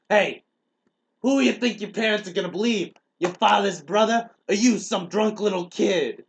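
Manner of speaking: slow down and lean hard on each phrase; overacted bullying adult, loud and high; mock menacing uncle. Uncle threatens kid in quarrel.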